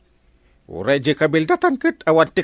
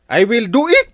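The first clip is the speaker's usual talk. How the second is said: proud boast; 9 semitones higher, 7 dB louder than usual. Acted. Boastful husband promises to act.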